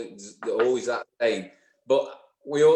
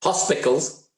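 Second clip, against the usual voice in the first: louder mock Yorkshire "Hospitals"; imitating the Yorkshire accent; 3 semitones higher, 5 dB louder, slower than usual. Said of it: "that's my Yorkshire accent there coming out".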